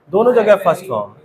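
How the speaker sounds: usual speaking voice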